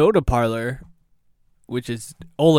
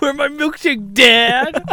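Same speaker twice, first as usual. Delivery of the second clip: very high-pitched demand, louder on Dad; hammed-up tantrum building to the last word; mock outraged customer; 13 semitones higher, 5 dB louder than usual. Repeats the customer impression, escalating.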